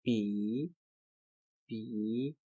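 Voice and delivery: "E" said clearly for learners; Chinese word model. Shown as a demonstration. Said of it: "This is".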